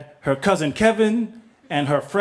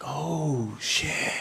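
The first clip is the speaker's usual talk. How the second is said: hushed awe; 4 semitones lower, 8 dB quieter than usual. Retold or imitated. Awed in the elevator.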